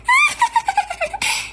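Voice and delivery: high gloating giggle; high mean-girl laugh; mocking laugh. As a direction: laugh at her in triumph.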